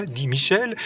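plain reading voice

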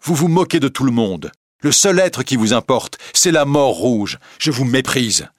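jealous Raoul insulting Christine, loud. Acted. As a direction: spit the contempt at her. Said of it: "Christine se laissa injurier".

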